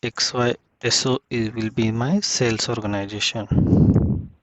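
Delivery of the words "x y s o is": ordinary talk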